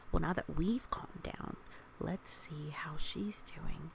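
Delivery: plain reading voice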